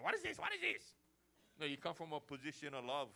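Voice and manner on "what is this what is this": high, sharp mock challenge; preacher mocking a pushy believer grabbing a cross; mock aggressive confrontation. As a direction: jab the question like grabbing him. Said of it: "grab the cross around his neck".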